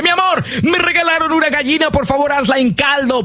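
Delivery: high, delighted call of good news; excited husband. Acted. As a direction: burst in thrilled with the gift. Poor husband brings chicken home.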